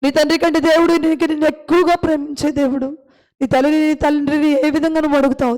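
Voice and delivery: ordinary talk, nothing marked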